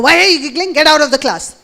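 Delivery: loud teacher's telling-off; imitating an irritated teacher; mock scolding. Acts out a cross teacher.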